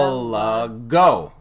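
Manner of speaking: Archipelago slowly, syllable by syllable, hitting AR and PEL; drawn-out Archipelago, loud first and third beats; stress on first and third syllables. Shown as a demonstration. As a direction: break Archipelago into five slow beats, punching AR and PEL. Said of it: "Say it slowly, emphasizing the first and third syllables".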